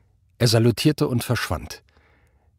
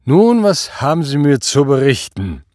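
loud, higher, slurred question; drunken slur; 4 semitones higher, 7 dB louder than usual. A narrator's reading. Drunken Obergruppenführer demands a report from his officer, slurring. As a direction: slur it out like a tipsy boss. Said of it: "fragte er lallend".

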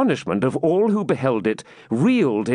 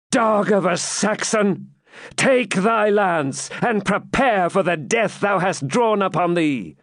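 fierce exclamation; 8 semitones higher than usual. Acted. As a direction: spit the challenge at him. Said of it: "exclaimed, looking fiercely at Ivanhoe".

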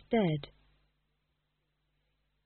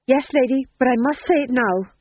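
resolute fool; 6 semitones higher, 6 dB louder than usual. Acted. Fool answers.

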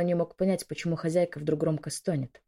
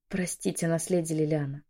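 usual speaking voice

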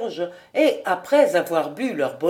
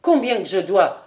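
usual speaking voice

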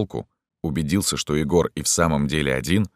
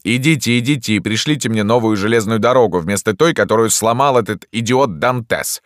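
raised, louder, irritable; grumbling doctor; 7 semitones higher, 6 dB louder than usual. A narrator's reading. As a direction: grumble them out crossly. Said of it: "проворчал доктор".

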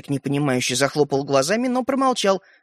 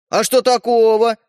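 loud, slow, higher protest; defensive; 5 semitones higher, 6 dB louder than usual. Acted. Стёпа defending his gift, defensive. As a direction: push back, pitched up and stretched.

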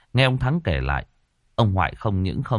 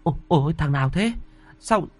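put-on high, frightened old woman's cry; teeth-chattering terror, voice climbing high; terrified; 12 semitones higher than usual. A narrator's reading. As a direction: shaken woman asking who crawls up from the river. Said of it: "răng môi đánh vào nhau lập cập".

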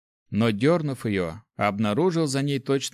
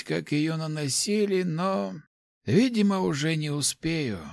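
put-on higher, fond old mentor voice; old rector voice; 7 semitones higher, slower than usual. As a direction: speak as a kindly old master. Rector speaks to assassin.

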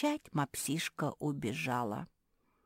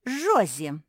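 loud accusing exclamation; blurted out; 5 semitones higher, 10 dB louder than usual. A narrator's reading. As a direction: blurt the name loudly. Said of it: "выпалила Куки".

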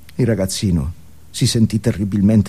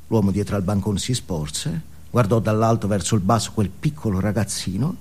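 ordinary talk

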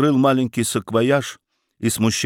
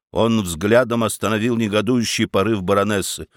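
plain reading voice

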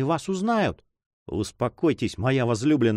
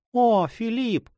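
high anguished cry of his name; grieving cry; 10 semitones higher, slower than usual. A narrator's reading. Juana hearing he must leave, high sorrowful cry. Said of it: "вскричала она с горестью".

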